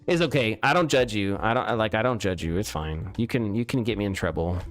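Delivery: usual speaking voice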